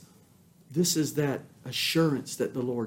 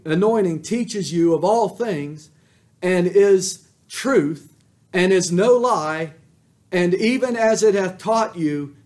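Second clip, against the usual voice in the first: loud, high preaching of the verse; fiery scripture reading; preached scripture; 6 semitones higher, 9 dB louder than usual. Acted. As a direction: proclaim the verse from the pulpit. Scripture preached at full force.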